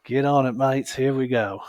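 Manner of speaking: playful amateur Australian impression, projected loudly; Australian accent. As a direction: put on a hearty, loud Aussie accent for the quote. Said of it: "should try my Australian accent".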